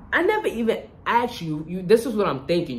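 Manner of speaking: loud, high-pitched protest; retold indignation. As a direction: act out an affronted comeback. Retold indignant reaction to friend.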